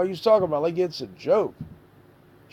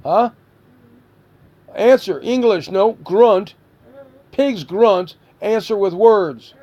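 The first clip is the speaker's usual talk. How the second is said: louder, sharp commands; stern demand; 3 semitones higher, 6 dB louder, slower than usual. Real, not acted. Parent demanding answer from son.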